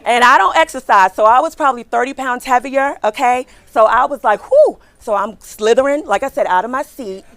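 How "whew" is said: sharp loud high exhale of effort; loud high whew. Retold or imitated. Recruiter mimicking straining effort, loud whew. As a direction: blow out hard, high and loud.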